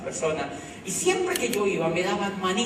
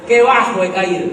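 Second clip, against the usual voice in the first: loud, dramatic cry of defeat; loud mock lament; 11 dB louder than usual. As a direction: belt it out as mock despair. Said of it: "decía mi corazón". (lamento dramático en voz alta)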